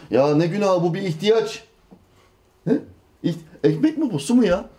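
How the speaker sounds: high-pitched mock incredulity; acted dismissive protest; scoffing disbelief. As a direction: brush it off as absurd, pitch jumping up. Youth scoffing that sin is need.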